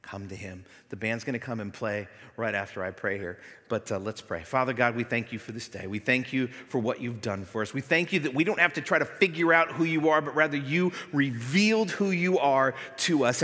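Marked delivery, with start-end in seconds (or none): 4.4-13.4 loud, lifted prayer voice; fervent prayer. Acted. Pastor praying aloud for the congregation, fervent. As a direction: pray it out over the church.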